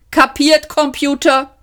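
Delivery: high, brisk checking question; a cheery captain making sure the computer got it; lightly voiced captain.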